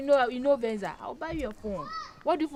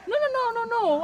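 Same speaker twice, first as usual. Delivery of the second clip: alarmed pleading; 10 semitones higher, 3 dB louder than usual. Acted. Trying to calm the quarrel.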